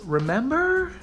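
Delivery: high, questioning American-accented "remember"; American "remember" with a rising question tune. Shown as a demonstration. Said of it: "What am I, American?".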